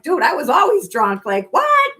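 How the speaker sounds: high, loud, squeaking up on what; incredulous. Retold or imitated. Telling friends she was always drunk, high incredulous squeal. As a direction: act amazed nobody noticed.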